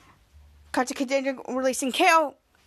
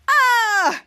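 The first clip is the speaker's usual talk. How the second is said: loud, higher "ah" of pain; pained cry; 6 semitones higher, 6 dB louder than usual. Retold or imitated. Villain whose finger just broke, pained yell. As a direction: act out a hurt yell.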